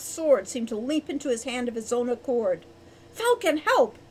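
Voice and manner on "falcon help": high, loud call for help; shouted. A narrator's reading. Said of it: "Hawk shouted".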